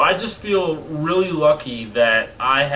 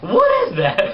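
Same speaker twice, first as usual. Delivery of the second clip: squeaky high crack imitating the slip; mock voice crack; 4 dB louder than usual. Vlogger jokingly repeats his own cracked voice, squeaking high. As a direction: redo the crack on purpose, way up high. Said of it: "My voice just cracked".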